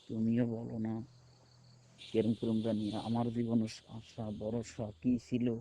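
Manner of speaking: low, very soft, worried murmur; downcast confiding. Real, not acted. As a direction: confide your troubles quietly, voice sunk low. Confiding worries about a life in disarray. (মনমরা, নিচু গলায় দুঃখের কথা)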